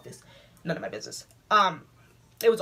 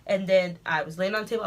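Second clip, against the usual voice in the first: ordinary talk, nothing marked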